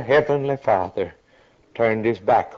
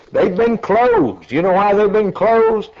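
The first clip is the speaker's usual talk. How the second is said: high preaching cry; 8 semitones higher, 3 dB louder than usual. Real, not acted. Preacher crying out to the congregation, high and ringing. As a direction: lift the voice high and stretch it. Preacher cries out about closed gates.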